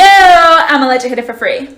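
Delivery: usual speaking voice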